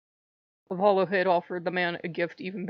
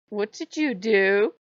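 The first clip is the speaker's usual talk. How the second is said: suspicious mother; slower than usual. Retold or imitated. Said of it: "his mother's like".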